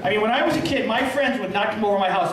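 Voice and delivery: normal talking voice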